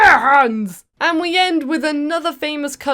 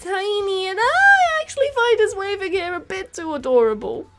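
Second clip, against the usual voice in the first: cooing; 6 semitones higher than usual. Real, not acted. Vlogger gushing over a tiny waving villager, cooing. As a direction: coo over something tiny and cute.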